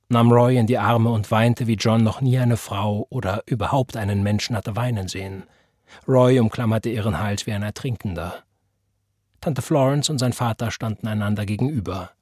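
ordinary delivery, nothing marked

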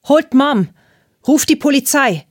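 loud, high-pitched yell for help; urgent yell. A narrator's reading. Sophie yelling to her sisters for help, urgent yell. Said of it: "brüllte Sophie".

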